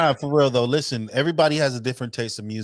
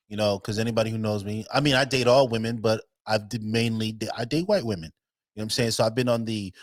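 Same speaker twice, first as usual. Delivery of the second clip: ordinary talk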